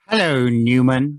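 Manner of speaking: slow, low sitcom-style greeting; lightly put-on, drawn-out low greeting; Jerry Seinfeld. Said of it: "It's gonna be Jerry".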